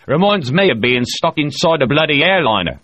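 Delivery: a loud boastful spacer holding court; O'Hara voiced. A narrator's reading. O'Hara brags to the crew, loud and bluff.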